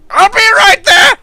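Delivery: very high, loud gnome shout. Acted. Gnome shouting from the back room, high frantic gnome yell. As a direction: holler in a high-pitched gnome voice. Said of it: "That gnome voice".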